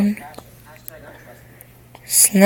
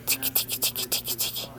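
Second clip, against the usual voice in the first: tickle whisper; 9 semitones lower, 17 dB quieter, much faster than usual. Real, not acted. Tickling the baby.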